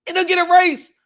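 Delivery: ordinary talk, nothing marked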